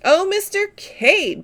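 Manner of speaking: high, slow little cry of mock dismay; a protest drawn out and lifted high; distressed protest. A narrator's reading. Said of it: "protested Miss Taylor, much distressed".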